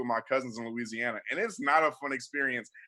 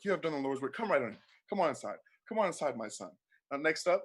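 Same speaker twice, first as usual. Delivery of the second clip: soft, hushed, kindly check-in voice; gentle Jesus; 6 dB quieter than usual. Acted. Jesus greeting the line at heaven's gate, soft and kind. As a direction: welcome each soul gently at the gate.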